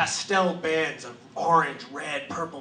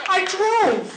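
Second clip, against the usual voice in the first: high laughing voice; 7 dB louder than usual. Retold or imitated. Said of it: "he goes".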